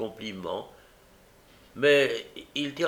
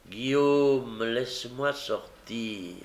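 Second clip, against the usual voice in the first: pleading; slower than usual. Acted. Young woman on the floor begs Guillaume, pleading. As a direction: beg gently, stretching the words.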